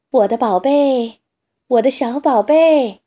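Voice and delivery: loud, searching call for her duckling. Acted. Mother duck calling for lost duckling, loud call. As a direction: call across the grass for your little one. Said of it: "鸭妈妈呼唤着".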